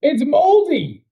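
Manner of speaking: high, slow, loud disgust; disgusted outburst. Real, not acted. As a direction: shout it in disgust. Reacting to rotten chicken.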